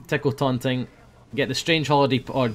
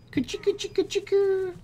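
put-on baby-talk coo. Tickle taunt in baby voice.

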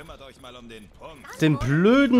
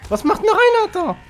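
surprised; 4 dB louder than usual. Real, not acted. Surprised at teammate in game.

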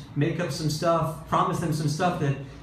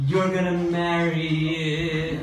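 dangling proposal; 2 semitones higher, much slower than usual. Retold or imitated. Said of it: "you don't say".

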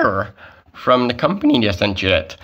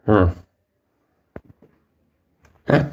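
ordinary delivery

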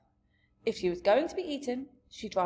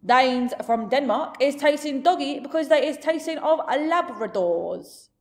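loud, raised giant voice; big giant voice, higher and louder; expounding giant; 5 semitones higher, 8 dB louder than usual. Acted. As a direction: hold forth as a blustering giant. Giant explains human flavours.

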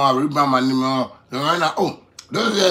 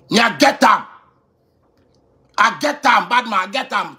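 higher and louder proud shout; boastful shout; 6 semitones higher, 4 dB louder than usual. Real, not acted. A rapper bragging to the interviewer, shouted high. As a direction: shout it out, proud of what you own.

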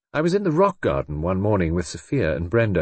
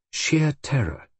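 higher, lightly dramatic delivery; quoted theatrical remark; 6 semitones higher, slower than usual. A narrator's reading. Magda talking about the play, lightly theatrical. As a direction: relish the phrase as in conversation.

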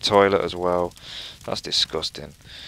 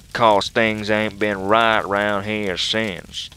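hillbilly voice; 5 dB louder than usual. Acted. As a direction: read it aloud as a hammed-up backwoods hillbilly. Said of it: "That's my hillbilly voice".

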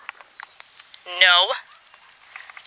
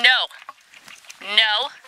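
normal talking voice

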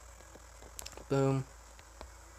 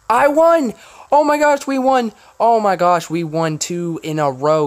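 shrieking jackpot winner; 8 semitones higher, 11 dB louder than usual. Real, not acted. Learns they won.

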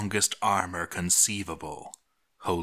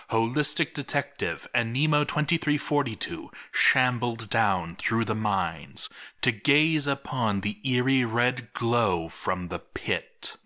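usual speaking voice

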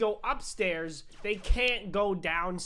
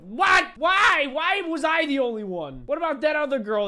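high, loud cry of disbelief; outraged shout; 8 semitones higher, 8 dB louder than usual. Acted. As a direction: wail in protest at the injustice. Mock outrage at losing in game.

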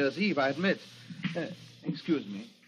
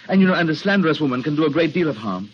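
louder, measured threat; veiled menace; 7 dB louder, faster than usual. Acted. Rolfe threatening Dorothy alone in his house, louder and menacing. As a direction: let the danger show under the politeness.

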